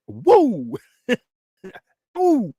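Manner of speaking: high, drawn-out whoop with laughter; excited whoop. Real, not acted. Vlogger celebrating the fruit of our labors, excited whooping. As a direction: whoop out loud, pitch soaring.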